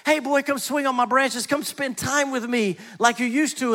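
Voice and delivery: a lightly voiced tree, excited to see the boy. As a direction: call the boy back to play, all eagerness. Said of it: "the tree got super excited".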